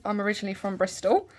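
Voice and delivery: usual speaking voice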